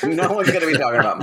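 rushed, high and giggling; laughing mock relief. Co-host voicing the relieved actress, high, fast and laughing. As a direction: gush it out fast through laughter.

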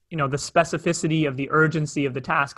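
ordinary talk, nothing marked

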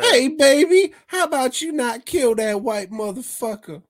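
high, loud mock woman's voice; hammed-up American wife. Mob boss's wife pleading for the man, hammed-up high voice. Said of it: "nope, she was American".

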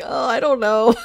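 nervous, half-laughing hesitation; a touch of hammed-up fright; mock scared. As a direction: play him spooked but laughing through it. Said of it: "his face is, like, scared".

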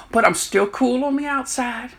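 normal talking voice

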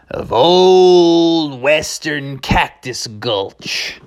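slow southwestern cowboy drawl. Acted. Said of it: "talking with a nice southwestern drawl".

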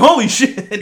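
loud startled exclamation; shocked. Retold or imitated. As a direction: blurt it out in shock. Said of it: "I was like".

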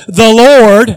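'The Lord' very loud; loud reading. Shown as a demonstration. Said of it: "It says".

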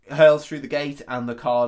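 normal talking voice

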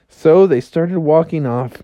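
usual speaking voice

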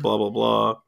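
ordinary talk, nothing marked

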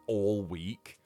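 normal talking voice

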